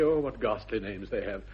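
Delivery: usual speaking voice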